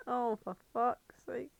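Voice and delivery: normal talking voice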